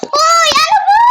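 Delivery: high, loud protest; annoyed shout. Real, not acted. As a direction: call out sharply at your playmate. Calling out playmate in squabble. (inis na pasigaw)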